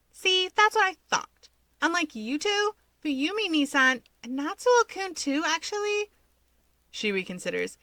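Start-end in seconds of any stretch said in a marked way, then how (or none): 0.1-6.1 put-on much higher, excited girlish chatter; bubbly gossiping girl. Himiko teasing the brothers about their siblings, bubbly gossip. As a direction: play the giddy gossip spilling secrets.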